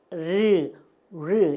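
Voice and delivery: 르 as a rounded English r, 'rwuh'; English R sound. Shown as a demonstration. Teacher recalling the R sound, rounded English 르. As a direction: say 르 from rounded lips, held briefly. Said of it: "이거라 그랬죠?".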